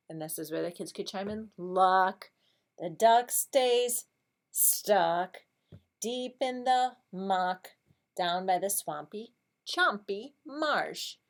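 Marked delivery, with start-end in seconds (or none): 1.3-11.2 slow, rhythmic story reading; picture-book reading. Shown as a demonstration. Teacher reads about moose helping the duck, slow lively storybook voice. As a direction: read it in measured, lively beats.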